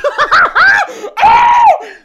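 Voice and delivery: very high, loud squeal of laughter; helpless shrieking laugh. Real, not acted. Storyteller loses it mid-story, shrieking with laughter.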